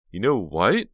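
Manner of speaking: loud, sharp flare of anger; flaring up. Acted. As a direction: snap back angrily. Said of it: "Jade flared".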